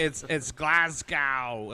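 GLASGOW with the end rhyming with cow; loud, slow "Glasgow", last syllable as "gow"; mispronounced Glasgow. Retold or imitated. Director on Instagram saying Glasgow wrongly, loud and drawn out. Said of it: "he was on Instagram being like".

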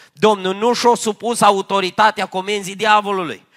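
loud, fervent preaching, driving each phrase home; preaching at full force. Acted. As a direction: proclaim it to the whole congregation. Preacher raises voice.

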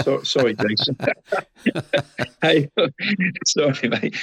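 real chuckle breaking up the words; laughing apology. Real, not acted. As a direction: apologise through laughter at your own slip. Amused at own slip, apologising.

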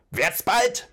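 wütend hoch und laut gebrüllt; brüll den Befehl rasend heraus; außer sich vor Zorn. Acted. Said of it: "schrie Bulgur außer sich vor Zorn". (rasender Wutschrei)

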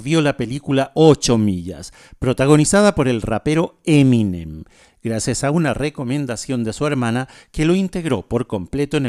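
brighter, livelier radio host read; radio announcer. Acted. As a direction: present the song like a DJ. Radio host introduces a song. (locutor de radio animado)

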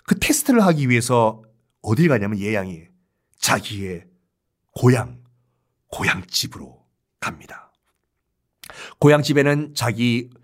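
usual speaking voice

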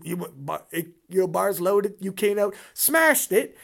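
usual speaking voice

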